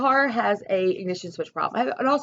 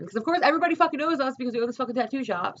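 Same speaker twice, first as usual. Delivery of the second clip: normal talking voice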